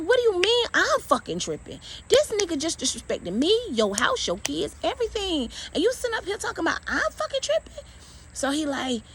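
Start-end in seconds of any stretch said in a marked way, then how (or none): 0.0-7.8 high, heated tirade, speeding up at the end; going off. Retold or imitated. Wife telling off husband for dismissing the break-in, heated. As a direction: lay into him, voice pitched up. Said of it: "I went off on his ass".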